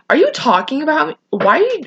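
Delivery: retold indignant outburst, pitched high; pissed off. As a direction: act out an annoyed, high-pitched challenge. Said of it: "I got so pissed".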